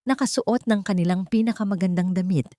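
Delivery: ordinary delivery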